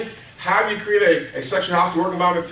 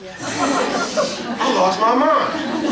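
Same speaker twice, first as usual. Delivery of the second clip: stretched-out laughing wail, pitched higher; laughing, drawn out; 6 semitones higher, much slower than usual. Real, not acted. Trainer laughing about a TV moment, drawn out. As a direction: let it dissolve into laughter, slowly.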